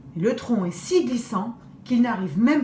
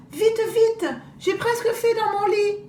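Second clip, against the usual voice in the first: put-on urgent little girl; 10 semitones higher than usual.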